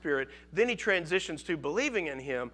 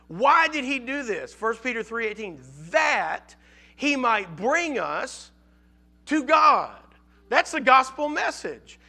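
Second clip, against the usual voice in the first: raised and louder, pressing each question on the congregation; fervent preaching; 5 semitones higher, 5 dB louder than usual. Acted. Preacher pressing the congregation on why Christ suffered, fervent and loud. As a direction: drive it home from the pulpit, full force.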